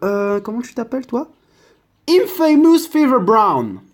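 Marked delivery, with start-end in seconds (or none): 2.1-3.8 loud, slow, high product announcement; over-the-top English product-name reveal; mock presenter. Announcing a style name, put-on English.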